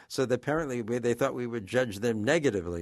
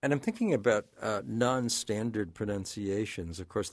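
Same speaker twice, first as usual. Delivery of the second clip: usual speaking voice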